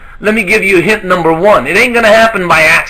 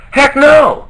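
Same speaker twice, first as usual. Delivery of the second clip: higher, emphatic "no"; emphatic denial; 7 semitones higher than usual. Real, not acted. Coach answering his own question about a good year, emphatic denial. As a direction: knock the idea down with a high-pitched answer.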